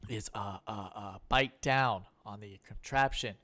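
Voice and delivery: hesitant stammering threat; Jeff Goldblum. Retold or imitated. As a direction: stumble through the trap rules. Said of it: "still going".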